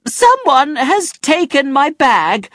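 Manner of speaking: high, slow, desperate scream. A narrator's reading. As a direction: cry out the loss slowly. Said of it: "she screamed".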